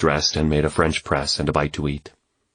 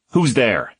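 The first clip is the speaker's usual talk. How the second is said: shout; 5 semitones higher than usual. A narrator's reading. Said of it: "he shouted".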